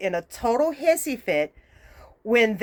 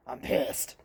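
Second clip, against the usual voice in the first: low, hushed, seething aside; quietly pissed; 10 semitones lower, 9 dB quieter than usual. Real, not acted. Fed-up viewer repeating her complaint, quietly pissed. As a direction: drop right down in pitch and volume.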